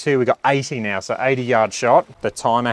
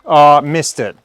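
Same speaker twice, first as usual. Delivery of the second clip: disappointed exclamation; 4 semitones higher, 9 dB louder, slower than usual. Real, not acted. Reacting to a missed shot.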